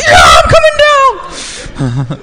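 very high, loud excited screech; ecstatic contestant scream. Retold or imitated. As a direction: scream it like a contestant racing to the stage. God again answers like screaming contestant.

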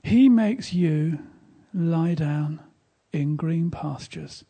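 very slow, loud psalm proclaimed over the listeners; drawn-out, ringing blessing with long pauses; solemn scripture proclamation. Real, not acted. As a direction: declare it over them word by word, projecting.

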